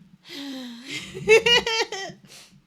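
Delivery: high, loud crying wail; realistic mock cry. Co-player in the game, loud pretend crying. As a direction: wail as if really sobbing. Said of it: "That sounded so real, that cry".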